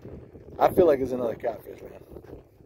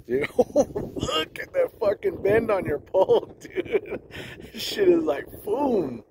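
excited laugh; 8 semitones higher, much slower than usual. Real, not acted. Huge bend on fishing pole.

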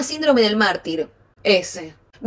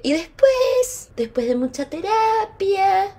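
high, very drawn-out syllables; theatrical build-up; 9 semitones higher, much slower than usual. Acted. Recounting her therapy journey to followers, theatrical build-up. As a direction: stretch each phrase grandly upward.

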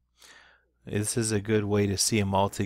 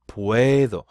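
"puedo" with the first syllable stretched and louder; stressed syllable; 7 dB louder than usual. Shown as a demonstration. Teacher showing students the stem change, stress on the "pue" of "puedo". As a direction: lean hard on the "pue" in "puedo". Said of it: "When you emphasize that syllable".

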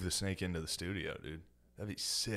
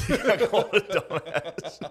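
words squeezed out through big laughter; cracking up, high and loud; laughing; 11 semitones higher, 8 dB louder than usual. Real, not acted. Cracking up over the dumbass comment, loud high laughter.

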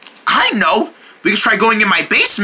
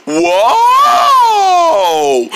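whoa shout; 10 semitones higher, 4 dB louder than usual. Acted. Clown falls over.

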